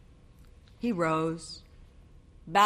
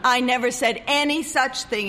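heated denial; 7 semitones higher, 5 dB louder than usual. A narrator's reading.

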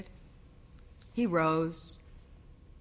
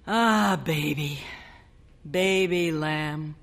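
tender endearment; slower than usual. A narrator's reading.

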